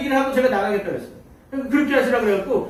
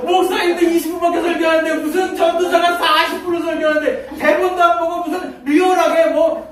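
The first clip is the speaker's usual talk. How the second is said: high, raised-voice grumbling; mock indignant complaint; 5 semitones higher, 3 dB louder than usual. Teachers griping about the young preacher's forty-minute sermon, indignant. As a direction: protest loudly in comic outrage.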